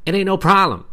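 high, loud meme impression; Shannon Sharpe voice. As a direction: shout it like the meme. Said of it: "in her best Shannon Sharpe meme voice".

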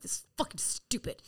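whispered grumble again; muttered whisper. Retold or imitated. Grumbling at the sponsor's plan, whispered. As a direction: mutter it under your breath. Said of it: "I'm like".

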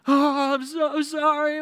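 very high, loud, drawn-out fake wail; over-the-top mock crying. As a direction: bawl the apology at double intensity. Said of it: "ramp it up even more".

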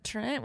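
TRENT said high and rising; "Trent" lifted into an unsure question. Retold or imitated. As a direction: say "Trent" as a texted question mark. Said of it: "with a question mark".

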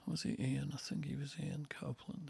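whisper. Real, not acted. Unsure aside while recalling a name.

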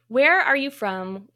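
ordinary talk

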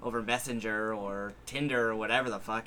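ordinary talk, nothing marked

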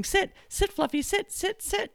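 high frantic mock yell of sit. Owner shrieking at the bouncing dog, high mock yell. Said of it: "you're yelling".

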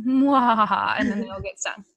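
laughing through the words; giggling while saying it. Real, not acted. Joking about wasps stinging kids.

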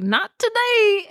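dramatic defiance. Retold or imitated. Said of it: "she's like".

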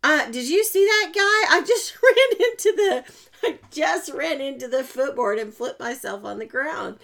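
yelling to the cat mid-laugh, then settling. Retold or imitated. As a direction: shout across the room at her cat, giggling. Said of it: "I started yelling at the cat".

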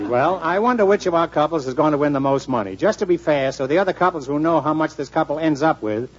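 normal talking voice

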